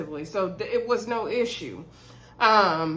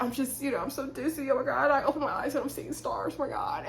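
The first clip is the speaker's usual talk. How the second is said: higher, softer mock dizzy voice; lightly voiced fainting spell; faint dizzy woman; 4 semitones higher, 5 dB quieter than usual. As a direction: imitate someone woozy and weak. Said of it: "she was like".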